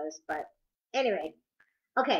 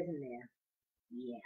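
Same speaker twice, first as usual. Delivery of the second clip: ordinary delivery, nothing marked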